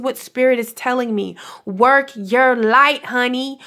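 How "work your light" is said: each word drawn out slowly, rising on light; slow emphatic urging. Real, not acted. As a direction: lay it on slowly, like a blessing. Spiritual pep talk to viewers.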